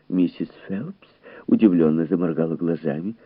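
usual speaking voice

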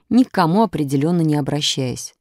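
ordinary delivery, nothing marked

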